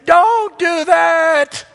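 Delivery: loud, high, drawn-out shouted warning; acted warning shout. Heart warning a person off wrongdoing, sudden loud shout. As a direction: shout it slowly, stretching out each word. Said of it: "my heart shouted at me".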